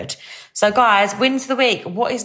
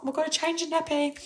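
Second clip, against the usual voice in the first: proud squeal; 10 semitones higher, 8 dB quieter, faster than usual. Retold or imitated. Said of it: "I was like".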